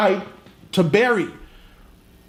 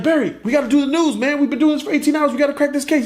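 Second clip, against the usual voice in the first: higher, fast pestering colleague; hurried coworker; 8 semitones higher, faster than usual. Retold or imitated. Coworker hurrying Barry at the desk, higher and fast. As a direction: rattle it off like an impatient coworker.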